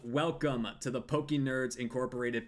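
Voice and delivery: slow, big showman welcome; announcer intro. Acted. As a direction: boom out the opening like a host. Opens the podcast like announcer.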